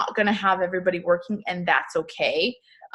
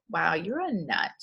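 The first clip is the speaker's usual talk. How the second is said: softer, unvoiced teasing whisper; hushed aside; 4 semitones lower, 8 dB quieter than usual. A new recruit privately doubting her, whispered. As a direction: murmur it like a skeptical newbie. Imagined newcomer's doubtful reaction.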